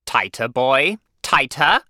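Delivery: loud, high, rising orders; sharp, impatient schoolmaster; snapped out. A narrator's reading. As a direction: snap commands like a strict headmaster. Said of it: "Mister Coombs snapped out".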